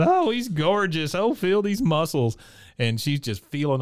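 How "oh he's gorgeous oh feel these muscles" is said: high-pitched mock schoolgirl swooning; imitating a girlish fawning voice; giggly schoolgirl. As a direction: gush like a smitten schoolgirl, pitched well up. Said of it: "giggles like a schoolgirl".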